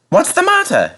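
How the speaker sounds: very high engine question; an engine asking why he is stuck; high, anxious question; puzzled James. A narrator's reading. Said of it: "James asked his driver".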